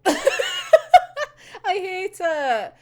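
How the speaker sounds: high, slow laughing groan; laughing despair. Real, not acted. Fan over a sad panel, high laughing groan. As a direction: drag it out through the laugh.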